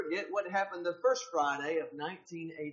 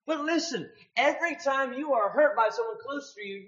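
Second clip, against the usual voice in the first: loud, higher impassioned preaching; full-force preaching; 8 semitones higher, 7 dB louder than usual. Acted. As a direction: drive the point home to the congregation. Preaching climax, raised voice.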